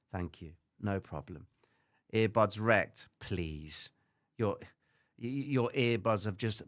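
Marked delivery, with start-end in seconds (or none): none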